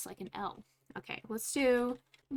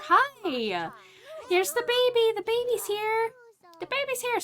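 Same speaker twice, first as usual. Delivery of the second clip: much higher and louder, squealing with joy; high, loud, thrilled baby announcement; excited sim voice; 12 semitones higher, 6 dB louder than usual. Pretend. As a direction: gush like a thrilled new mom. Voicing the Sim greeting the baby.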